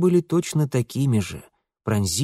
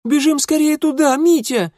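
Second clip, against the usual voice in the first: put-on high, loud girlish urging; urgent plea; 6 dB louder than usual. A narrator's reading. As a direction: urge him on excitedly, pitched very high. Sisters urge Mitya to run.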